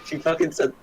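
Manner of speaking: usual speaking voice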